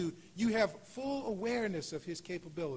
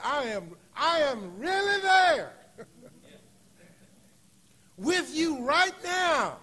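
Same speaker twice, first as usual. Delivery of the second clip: high, loud and slow: I am really there; voicing God, high and loud, slowly; emphatic promise; 10 semitones higher, 11 dB louder, slower than usual. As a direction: proclaim it as God's own assurance. God's promise voiced emphatically.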